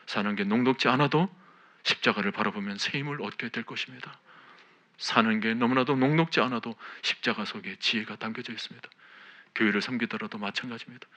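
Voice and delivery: loud, ringing preacher's exhortation; fervent sermon delivery; full-force preaching. Acted. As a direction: preach it out with conviction to the whole church. Preaching to the congregation.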